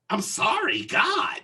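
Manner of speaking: high, exasperated mock apology; snotty apology. Imitating cart woman's grudging apology, snotty. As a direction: throw out the apology with annoyance. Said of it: "you know, like that. Not like".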